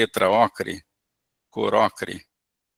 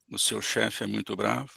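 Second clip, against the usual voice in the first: quiet, gentle echo; calm soft mirroring; 9 dB quieter than usual. Shown as a demonstration. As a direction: mirror it back softly. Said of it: "com calma".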